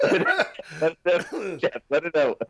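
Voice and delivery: high-pitched laughing tease; mock consoling. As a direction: comfort a friend jokingly through laughter. Teasing friend for supposedly crying.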